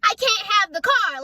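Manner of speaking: high, indignant retold rant; mad all over again. Reliving the car argument with parents for viewers, mad all over again. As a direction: argue it out angrily, pitched well up. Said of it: "I'm getting mad all over again".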